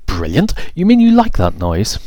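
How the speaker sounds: high, shocked disbelief; can't believe what she hears; incredulous. Acted. Violet disbelieving.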